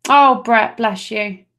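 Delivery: usual speaking voice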